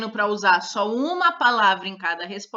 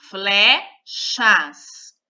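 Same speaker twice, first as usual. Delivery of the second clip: Flechas split into fle and chas, louder; syllable by syllable; 5 dB louder than usual. Shown as a demonstration. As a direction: break Flechas into two separate beats. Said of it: "duas sílabas". (Flechas separando as sílabas)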